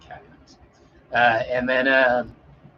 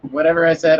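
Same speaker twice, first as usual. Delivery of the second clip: louder repeat; 4 semitones higher, 10 dB louder, faster than usual. Real, not acted. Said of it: "Hopefully whatever I said".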